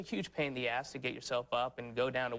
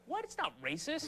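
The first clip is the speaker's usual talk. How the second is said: exasperated retort; 11 semitones higher, 3 dB quieter than usual. Retold or imitated. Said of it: "You're like".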